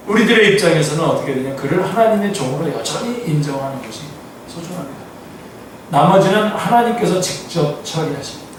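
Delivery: usual speaking voice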